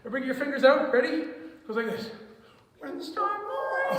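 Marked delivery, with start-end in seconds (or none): 2.8-4.0 squeaky sung line, much higher, voice cracking; little Mickey Mouse voice. Shown as a demonstration. Teacher with covid trying a tiny cartoon-mouse voice for the kids. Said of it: "like a little Mickey Mouse, Minnie Mouse way".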